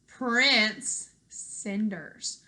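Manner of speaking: drawn-out title, each word savoured; slow title announcement. Shown as a demonstration. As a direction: dangle the title slowly like a teaser. Announcing the next book title.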